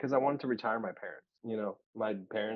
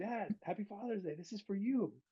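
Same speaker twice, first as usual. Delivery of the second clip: much higher, beaming correction; delighted reveal; 13 semitones higher than usual. Retold or imitated. Son telling dad the car is his, beaming. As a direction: sing it out as the gift reveal.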